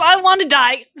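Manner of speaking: high, loud swooning; overwhelmed adoration. Acted. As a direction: gush as if dying of cuteness. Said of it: "I'd be like".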